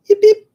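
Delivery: high, loud little yelps; playful yip. Pretend. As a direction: yip twice like a small dog. Playful yelp between remarks.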